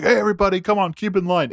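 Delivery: imitating the doorman at the restaurant; mock crowd-control call. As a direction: herd the queue like a bouncer. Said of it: "his whole character".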